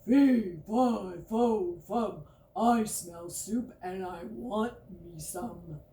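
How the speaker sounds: loud booming giant chant; hammed-up giant roar. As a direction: stomp out each syllable like a giant. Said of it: "The giant said something like".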